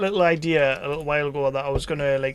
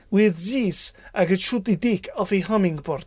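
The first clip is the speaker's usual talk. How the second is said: hammed-up Russian accent, slightly raised; 4 semitones higher than usual. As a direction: imitate a Russian woman with a thick accent. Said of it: "That was Russian".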